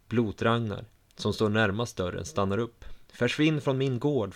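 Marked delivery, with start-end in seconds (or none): 3.1-4.3 mild whiny shout, pitched higher; whiny man's yell. A narrator's reading. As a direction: order them off the farm like a whiny man. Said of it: "fortsätter rösten".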